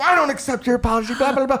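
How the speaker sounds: high, fast tirade; hammed-up, gabbled indignation; mock angry rebuff. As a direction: imitate an irate woman refusing to hear it. Acted rejection of phone apology.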